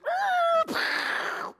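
loud high shouted crash noise; imitated crash boom. Pastor acting out the car crash for the congregation, a loud shouted boom. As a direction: blast out the sound of the car slamming.

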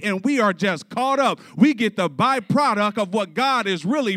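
emphatic pulpit delivery; impassioned preaching. Real, not acted. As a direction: preach it hard and high to the congregation. Preaches with rising force.

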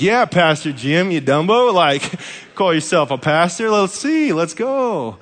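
high, loud teasing taunt; mock gloating. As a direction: gang up on Jim, hammed-up. Mock ganging up on Jim.

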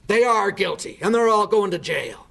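a touch of a fierce, indignant man, pitch up a little; imitating an indignant churchman. Church classmate retorting to the speaker's prayer concern, mock indignant. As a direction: spit out the verdict like he's certain. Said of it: "just like this".

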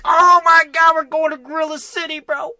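over-the-top fanboy glee; mock excited Wally. Retelling the ending to a friend, squealing with hyped mock excitement. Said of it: "And then you're like".

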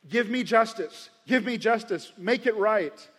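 loud, high demand for justice; crying out for justice. Acted. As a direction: insist on being made right. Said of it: "we cry out for justice".

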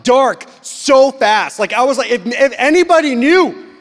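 loud, high mock-panicked shouting; terrified outburst. Youth pastor reliving what he overheard, terrified and loud. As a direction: hammer each phrase in hammed-up alarm. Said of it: "I'm terrified by the things".

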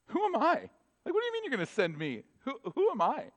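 high, disbelieving cry; incredulous. Acted. Moses protests to God, high and incredulous. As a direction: Moses can't believe he was chosen. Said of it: "comes to God and he says".

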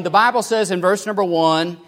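ordinary delivery

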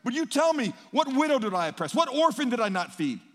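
loud, high, rising desperate pleading; Job's anguished plea. Retold or imitated. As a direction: cry out to friends, demanding answers. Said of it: "He says".

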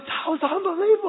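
put-on very high awestruck kid voice; amazed child. Son telling dad about the dolphin, high amazed voice. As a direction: gush it like pure wonder.

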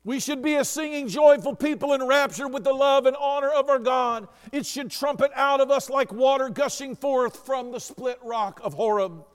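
impassioned preaching. Real, not acted. Preacher urging congregation to be joyful people, impassioned preaching. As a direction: proclaim it in one sustained, rising rush of fervour.

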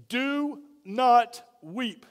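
ordinary delivery

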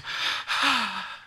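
put-on baby's contented sigh. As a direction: breathe out like a settling baby. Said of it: "they just go".

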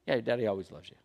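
low, soft fatherly voice; tender reassurance. Retold or imitated. Dad reassures his son, tender and low. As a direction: quietly comfort a small child.

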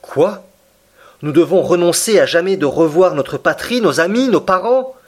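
the prisoner reels at never going home; dismayed outcry. Acted. Prisoner told he can never return. (cri d'indignation, voix haute)

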